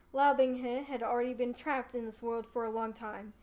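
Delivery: ordinary delivery, nothing marked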